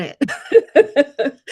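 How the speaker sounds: slow, rueful laugh through the words; a wry chuckle while admitting the letdown. Real, not acted. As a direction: laugh it off as you say it. Rueful telling of rejection.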